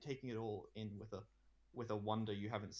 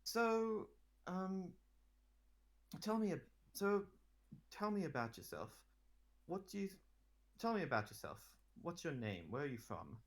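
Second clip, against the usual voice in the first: gentle queen; 8 semitones higher than usual. Acted. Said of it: "let him down easy".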